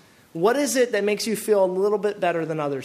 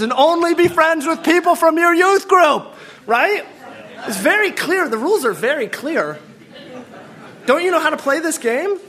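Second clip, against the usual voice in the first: rising mock zeal; 7 semitones higher, 5 dB louder than usual. Mocking legalistic rules, rising.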